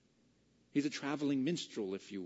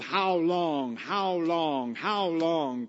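louder, whiny "how long" with each how stressed; mock complaining; 5 semitones higher, 8 dB louder, much slower than usual. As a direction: moan it like a fed-up sufferer. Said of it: "this attitude of".

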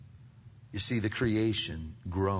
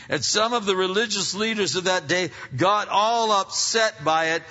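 high, loud, driving preacher's cry; full-force preaching; 11 semitones higher, 8 dB louder than usual. Acted. Preacher thundering to the congregation about creation shaking.

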